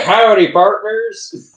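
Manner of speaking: loud, high cowboy drawl; stretched-out hammy Southern greeting; Southern accent. Acted. Co-host mocking dubbed Kansai accents, Southern accent. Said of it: "or Southern accents".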